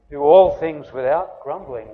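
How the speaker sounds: all things said loudly, stressed on all; preacher booms the verse at the congregation; emphatic loud quote. Shown as a demonstration. Preacher quoting Paul to the congregation, loud and emphatic. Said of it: "So when he's saying".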